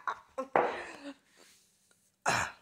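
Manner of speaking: normal talking voice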